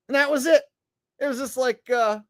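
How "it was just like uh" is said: normal talking voice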